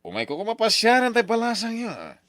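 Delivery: butter up the rich suitor in a high, honeyed tone; fawning sweet talk. Acted. Father fawns over rich Brian. (nangato ken nasam-it a panagsao a mangay-ayo iti nabaknang)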